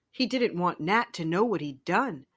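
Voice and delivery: ordinary delivery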